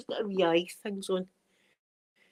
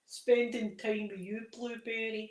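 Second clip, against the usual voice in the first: put-on Scottish accent; 5 dB quieter than usual. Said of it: "[name]'s got a big Scottish accent now".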